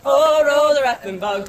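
ordinary talk

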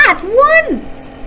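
shrieking bellow. A narrator's reading. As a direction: voice cracking up as control slips. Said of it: "he bellowed".

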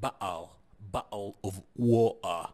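bottle of water as bo'le of wa'er, slow; slow Cockney bo'le o' wa'er; Cockney glottal stop. Shown as a demonstration. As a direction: swallow each T into a catch, Cockney. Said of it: "يقوم بنطقه بأه ساوند".

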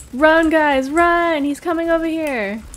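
high, loud urgent yell; shouting run. Real, not acted. Being chased by giant.

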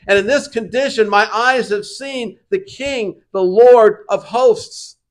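loud, high lament; anguished confession. Acted. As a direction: confess in broken awe. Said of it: "he says".

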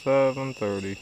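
ordinary talk, nothing marked

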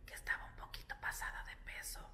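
whispered so the little one won't hear. Real, not acted. Mother whispering the doctor's verdict about her daughter, hushed whisper. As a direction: hush it out under the breath.

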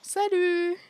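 high, loud sing-out hello; cheery greeting. Acted. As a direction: call out a big hello to the group. Acting out a greeting in-game.

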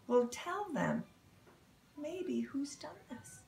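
usual speaking voice

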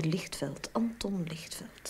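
hushed, slow whisper; secretive whisper to the boss; whispered name. Acted. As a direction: whisper the name so the visitor won't hear.

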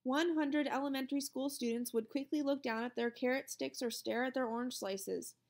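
usual speaking voice

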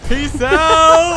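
very loud, high drawn-out shout; high whooping send-off; hollered farewell. Real, not acted. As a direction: yell it while leaping out. Calls out while jumping from plane.

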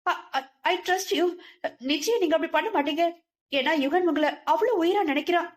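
high, fast, tearful appeal; tearful pleading. A narrator's reading. Daughter-in-law tearfully pleads with aunt, high and fast. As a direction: plead through tears with faltering trust. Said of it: "கண்ணீரோடு சொன்னவளை".

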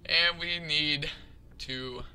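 ordinary talk, nothing marked

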